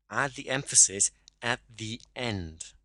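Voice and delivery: "end" slowly drawn out and emphasised; slow right down and land on "end"; stress on END. Shown as a demonstration. Coach modelling emphasis late in the sentence, stress on "end".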